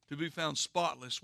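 ordinary talk

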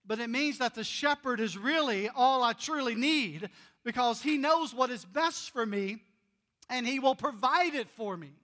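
loud, high preaching build; full-force preaching. Acted. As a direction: preach it out to the whole room.

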